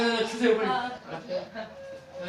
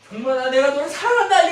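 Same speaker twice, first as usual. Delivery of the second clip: a louder, higher call of love; God's tender call; 9 semitones higher, 12 dB louder than usual. Acted. Preacher voicing God telling a believer he loves him, louder.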